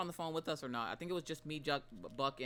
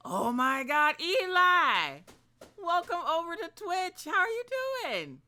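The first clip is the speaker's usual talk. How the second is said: much higher and louder, thrilled to see a friend; excited greeting; 8 dB louder than usual. Real, not acted. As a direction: squeal a delighted hello to a returning viewer. Streamer greets returning viewer Eli.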